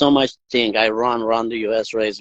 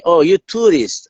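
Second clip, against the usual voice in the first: higher, louder dawning question; sudden realisation; 7 semitones higher, 6 dB louder than usual. Retold or imitated. Questioner works out the cyclist's mistake, sudden realisation. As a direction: act out the penny dropping. Retold listener realises the mix-up.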